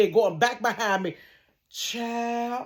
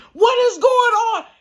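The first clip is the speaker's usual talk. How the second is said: neighbor calling out; 13 semitones higher, 6 dB louder than usual. Retold or imitated. Neighbors coming out.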